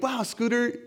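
high-pitched cheerleading compliments; mock gushing praise. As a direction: gush with exaggerated niceness. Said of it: "has to be like".

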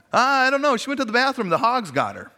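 hammed-up dad delivering his stock joke; joking dad. Dad to his kids, joking loudly that hogs got her. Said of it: "He'd say".

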